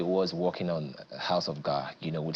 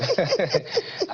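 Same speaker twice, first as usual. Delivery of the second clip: laugh; 13 semitones higher, 3 dB louder than usual. Real, not acted. Laugh during interview.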